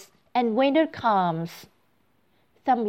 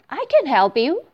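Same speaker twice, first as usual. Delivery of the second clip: bubbly forgetful goldfish voice; 7 semitones higher, 5 dB louder than usual. Acted. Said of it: "says the goldfish".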